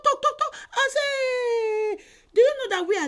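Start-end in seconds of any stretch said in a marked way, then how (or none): none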